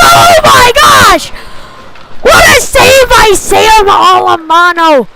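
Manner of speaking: usual speaking voice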